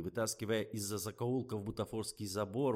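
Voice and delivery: usual speaking voice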